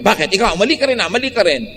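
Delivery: high, defensive mock comeback; angry retort. Spouse snapping back during a quarrel, mock angry. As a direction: throw the blame right back. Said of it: "Pero kung siya".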